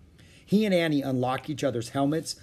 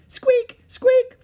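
very high, loud mouse squeak; 10 dB louder than usual. A narrator's reading. Peanut greeting Jack and Annie, mouse squeak. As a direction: squeak like a tiny excited mouse.

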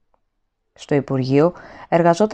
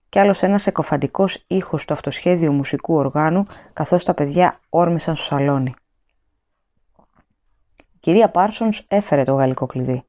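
ordinary delivery, nothing marked

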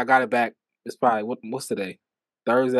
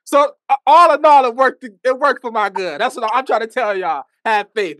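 excited; 9 semitones higher, 5 dB louder than usual. Real, not acted. Excited preachy.

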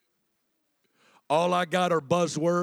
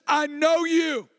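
slow earnest plea; 9 semitones higher, slower than usual. Acted. Voicing intimate prayer.

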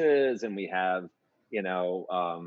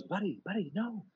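much higher-pitched imitation of an upbeat friend; acted excited reassurance; 13 semitones higher than usual. Writing partner calming upset composer, acted high-pitched excitement. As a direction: talk them down in a high, eager voice.

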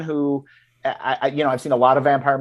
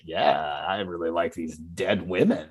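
much lower, softer, creepy relish; creepy off-putting man; 8 semitones lower, 7 dB quieter than usual. Retold or imitated. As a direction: savour it like an unsettling oddball. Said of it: "incredibly off-putting human being being like".

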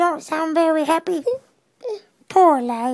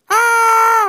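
higher, louder cry of fright; scared yell; 6 semitones higher, 7 dB louder than usual. Acted. Ralphie yelps as the lion chases him. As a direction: yelp as the lion charges.

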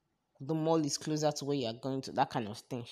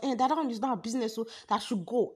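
high, loud mock telling-off; imitated shouting; 8 semitones higher, 5 dB louder than usual. Girl brushing off the plea to stay, imitated high shouting. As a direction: mimic the girl refusing to listen. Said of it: "she still goes short".

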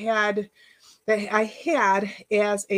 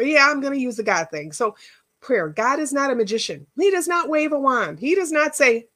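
usual speaking voice

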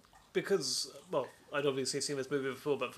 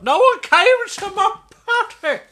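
shark tantrum; 16 dB louder than usual. Pretend. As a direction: wail it like a sulking child. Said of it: "He's just pissed off".

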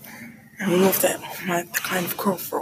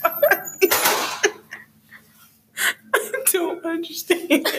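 laughing; 8 semitones higher than usual. Real, not acted. Laughing complaint about jiggling keys.

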